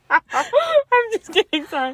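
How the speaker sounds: squealed through laughter, slow; giggling it out high and slow; laughing too hard to say it. Real, not acted. Laughing apology after joke.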